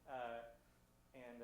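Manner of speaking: ordinary talk, nothing marked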